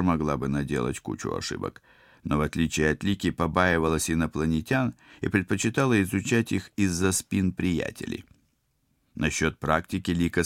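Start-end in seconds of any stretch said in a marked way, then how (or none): none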